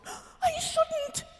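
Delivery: high indignant protest. A narrator's reading. Alice protesting she would not go out, indignant exclaim. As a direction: object sharply, offended. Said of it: "Alice exclaimed indignantly".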